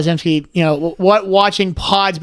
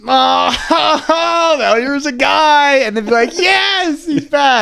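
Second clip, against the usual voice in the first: much higher, slow, shouted hype; hammed-up excitement; 7 semitones higher, much slower than usual. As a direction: act like the crowd favourite is back. Hyping the returning character.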